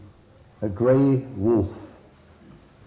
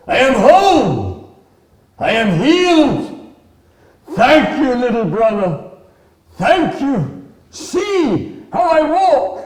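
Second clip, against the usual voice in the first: high, shouted exultation with held words; jubilant buffalo; 13 semitones higher, 10 dB louder, slower than usual. Acted. Healed buffalo thanks little brother, shouting high with joy. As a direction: cry it out loud, overjoyed at being cured.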